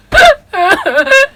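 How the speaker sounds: high sob-laugh breaking into tears; a pained laugh that cracks into crying; laugh turning into sobs. Real, not acted.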